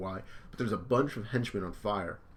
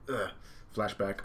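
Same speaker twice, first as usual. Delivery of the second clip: usual speaking voice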